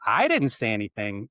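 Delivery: "I" pushed up with a rising end; "I didn't say anything" with the weight on "I"; stress on I. Shown as a demonstration. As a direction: lean on "I", as if someone else said it. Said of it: "if I emphasize I".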